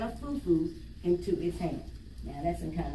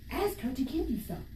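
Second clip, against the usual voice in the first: lightly voiced fairy; 3 semitones higher, 3 dB quieter than usual. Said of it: "she said".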